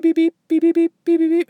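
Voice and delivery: high, quick beep beep beep like a timer; imitating watch beeps. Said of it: "it goes beep".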